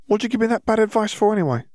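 quick, high-pitched teasing; grinning. A narrator's reading. Ginny teases Draco over bad advice, grinning. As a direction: tease with a grin, fast and high. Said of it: "she said, grinning".

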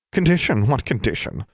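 sharp, suspicious question. A narrator's reading. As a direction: snap the question back. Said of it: "voice became sharp again".

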